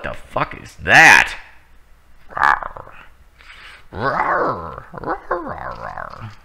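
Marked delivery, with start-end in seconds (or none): 2.3-6.3 playful fake growls; mock monster rawr.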